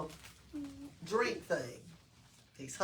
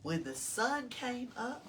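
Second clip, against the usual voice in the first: ordinary delivery, nothing marked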